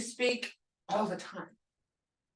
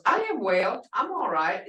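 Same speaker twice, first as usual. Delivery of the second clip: ordinary talk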